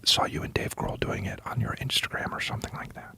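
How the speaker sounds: hushed mock ASMR; ASMR whisper. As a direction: close whispered question into the mic. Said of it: "Can you do some A S M R".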